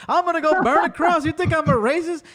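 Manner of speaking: high, loud mocking threat. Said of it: "She's like".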